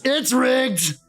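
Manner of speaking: high, outraged zombie yelp; indignant zombie cry. Acted. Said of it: "one of the splashing zombies just goes".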